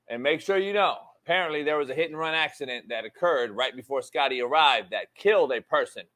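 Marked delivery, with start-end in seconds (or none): none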